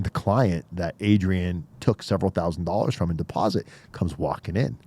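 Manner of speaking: ordinary talk, nothing marked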